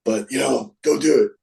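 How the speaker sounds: boss impression. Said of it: "He goes".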